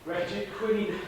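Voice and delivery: ordinary delivery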